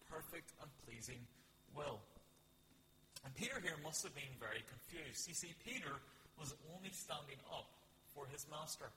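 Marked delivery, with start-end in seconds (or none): none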